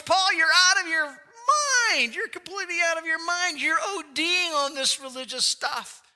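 high, loud, accusing shout; loud outburst. Retold or imitated. Festus shouts Paul down before the court, loud accusing outburst. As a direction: shout him down as if he's insane. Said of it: "Festus said in a very loud voice".

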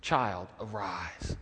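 slow, low, soft summons; gentle command. Retold or imitated. Jesus wakes the dead child, slow and tender. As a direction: draw out each word tenderly. Favourite line repeated as Jesus.

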